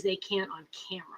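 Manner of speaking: soft whispered point; quiet whispered remark; whispered aside. Real, not acted. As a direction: drop to a whisper. Aside said low.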